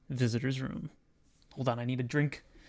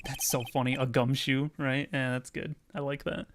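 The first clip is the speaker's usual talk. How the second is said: ordinary talk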